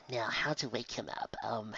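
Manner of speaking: whisper. Real, not acted. Sneaky plan to wake friend.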